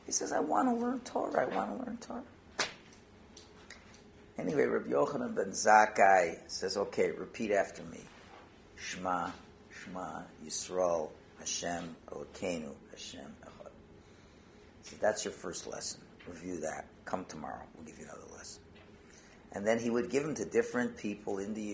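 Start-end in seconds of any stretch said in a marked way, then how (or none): 0.3-2.2 high-pitched tearful pleading; mock crying. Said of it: "He starts crying like a baby". 6.8-18.6 low, hushed, patient rabbi; acted tender, quiet instruction; gentle teacher. Rabbi gently teaches tearful beginner.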